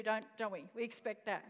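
ordinary delivery

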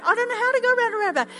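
panicked daughter. Retold or imitated. Daughter going straight through the roundabout, frantic and rushed. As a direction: blurt it out in a panic.

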